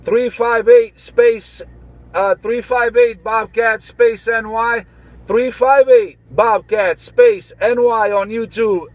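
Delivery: slow, drawn-out callsign read in a lightly put-on voice; imitating Bobcat. Slow, lightly mimicked callsign read to the CB crew. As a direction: spell out the callsign slowly like a buddy would. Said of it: "you know I was imitating Bobcat".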